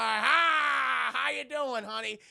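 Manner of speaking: booming mock-jolly grandpa; hearty greeting. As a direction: greet her with big cheer.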